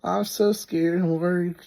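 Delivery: high, whiny parody of her panic; mocking whiny wife. Wife telling family he left her, high whiny mockery.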